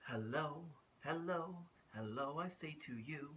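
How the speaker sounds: ordinary delivery, nothing marked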